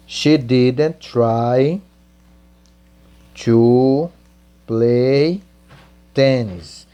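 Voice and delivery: each word slow and separate for students; slow dictation. Shown as a demonstration. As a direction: spell it out slowly word by word. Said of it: "você vai dizer". (ditado bem devagar)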